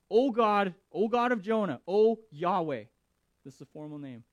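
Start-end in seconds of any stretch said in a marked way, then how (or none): 0.1-2.8 loud fervent pleading prayer. Retold or imitated. Sailors praying to Jonah's God, loud and pleading. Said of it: "And they're like".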